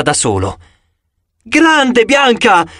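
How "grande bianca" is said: shouting. A narrator's reading. Hero cheers on Bianca inside the giant, shouting. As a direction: yell in triumph.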